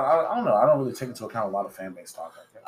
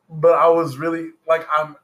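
higher, louder, eager; excited; 6 semitones higher, 6 dB louder than usual. Real, not acted. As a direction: let excitement break through. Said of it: "I'm really excited".

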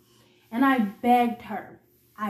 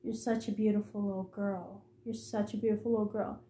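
much softer, hushed and creepy; whispering; 4 semitones lower, 12 dB quieter than usual. Retold or imitated. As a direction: breathe it out low like a predator's coo. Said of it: "Keisha just kept whispering".

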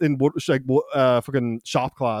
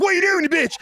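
very high-pitched protest; hammed-up shriek; mock outraged yell; faster than usual. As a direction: yelp it as if being whacked. Acts boy yelling while hit with mop.

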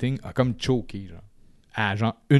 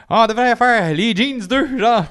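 much higher, louder voice imitating a friend; 11 semitones higher, 6 dB louder than usual. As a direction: pitch way up to mimic his pal. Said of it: "il fait tout le temps comme".

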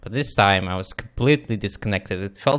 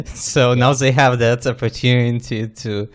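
laughing; 3 semitones higher, 5 dB louder than usual. Real, not acted. Teasing friend about YouTube comments.

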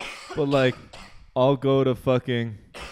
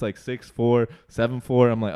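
normal talking voice

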